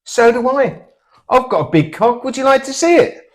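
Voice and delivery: high, loud cocky come-on; cheeky chat-up line. Retold or imitated. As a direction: sell it like a proud pub flirt. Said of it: "I said".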